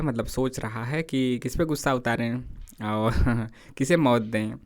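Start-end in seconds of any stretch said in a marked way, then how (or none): none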